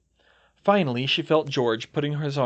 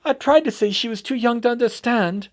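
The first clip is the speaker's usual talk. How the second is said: high, loud tearful plea; sobbing; 12 semitones higher, 7 dB louder than usual. Acted. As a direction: cry out the words. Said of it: "Septima sobbed".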